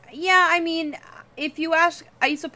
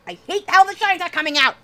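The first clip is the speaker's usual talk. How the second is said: frustrated outburst; 9 semitones higher, 6 dB louder, faster than usual. Real, not acted. Frustrated at drawing mishap.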